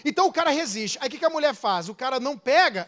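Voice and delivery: ordinary talk, nothing marked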